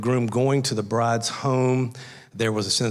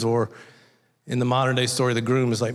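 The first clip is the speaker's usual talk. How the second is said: usual speaking voice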